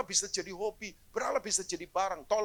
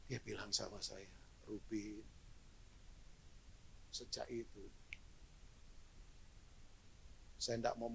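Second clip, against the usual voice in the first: hushed testimony; 10 semitones lower, 10 dB quieter than usual. Real, not acted. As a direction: draw them in with a hushed, moved tone. Moving testimony told softly. (kesaksian lirih dan haru)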